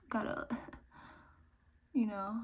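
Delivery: normal talking voice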